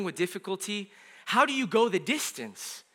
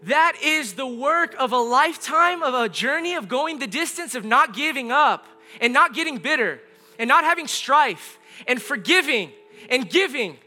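raised pitch, building through a list of phrases; impassioned preaching; 5 semitones higher, 4 dB louder than usual. Real, not acted. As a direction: preach it with insistent emphasis, stretching the key words.

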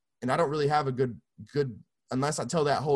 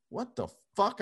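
retold disbelief; 8 semitones higher than usual. Acted disbelief at getting song.

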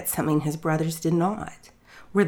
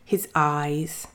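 ordinary delivery

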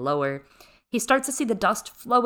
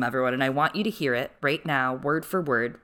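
ordinary delivery

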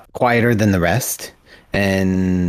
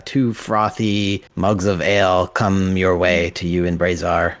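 ordinary delivery

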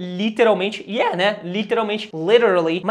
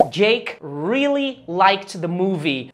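very slow teaching example; much slower than usual. Shown as a demonstration. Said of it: "Então, por exemplo".

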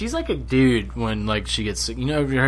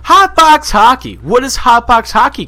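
announcer voice; 10 semitones higher, 7 dB louder than usual. Acted. Announcing the ad loudly.

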